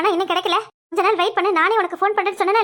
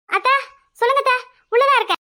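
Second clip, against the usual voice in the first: calling out; 7 semitones higher, 4 dB louder than usual. Acted. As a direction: call out loud and high to the aunt. Calling out to aunt from inside.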